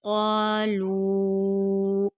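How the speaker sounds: क़ालू slow with a heavy क़ा and long लू; full and drawn out. Shown as a demonstration. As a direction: hold both vowels of क़ालू, full-mouthed q. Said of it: "तीन अलिफ की मिक़दार के बराबर". (क़ालू, पुर और खींच कर)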